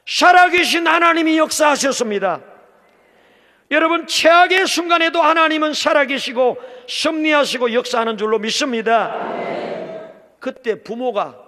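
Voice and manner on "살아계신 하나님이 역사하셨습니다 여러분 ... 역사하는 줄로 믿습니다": loud, high proclamation to the congregation; fervent preaching. Real, not acted. Pastor proclaiming God's work to the congregation, loud and fervent. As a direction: preach it with full force.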